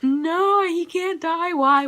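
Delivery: high, loud anguished cry, pleading; distraught wail. Retold or imitated. Reliving her reaction to the tombstone, high distressed cry. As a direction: protest the hero's death in a rising wail. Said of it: "and I was like".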